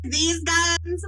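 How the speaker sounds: high, playful mock modesty; coy flexing bravado. Strong woman teasing William about her guns, coy and playful. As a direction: show off the muscles with a coy grin.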